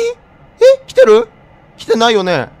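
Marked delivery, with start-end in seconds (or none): 0.0-1.3 high scared cry; frightened squeal. Real, not acted. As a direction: squeal in fear. Scared something is coming.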